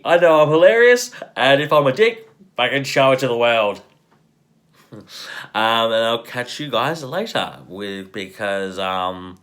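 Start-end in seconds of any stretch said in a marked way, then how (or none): 0.1-3.8 loud boast. Real, not acted.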